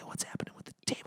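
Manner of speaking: hushed, wary whisper; two friends whisper about a haunted table. Pretend. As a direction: whisper nervously at the glowing table. Acting cautious whisper at table.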